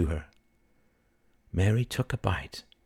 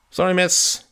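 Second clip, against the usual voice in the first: high, loud, offhand dismissal; careless young shop assistant; 11 semitones higher, 5 dB louder than usual. Acted. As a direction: brush her off in a raised voice. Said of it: "said the young man carelessly".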